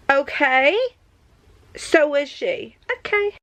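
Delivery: outraged. Real, not acted.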